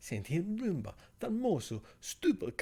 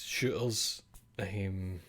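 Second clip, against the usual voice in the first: usual speaking voice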